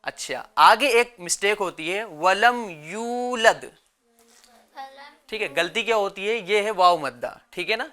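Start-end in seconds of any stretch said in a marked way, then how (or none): 2.0-3.6 slow, measured tajweed recitation; Quran recitation. Shown as a demonstration. Teacher models the verse for children, slow recitation. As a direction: recite slowly with drawn-out vowels.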